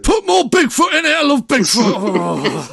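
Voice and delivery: loud, high hammed-up shout, laughing through it; Northern producer impression. Mocking the film's producers to friends, a loud laughing high Northern shout. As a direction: bellow it like an excited Northern producer, slowing on the last bit. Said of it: "the producers were Northern".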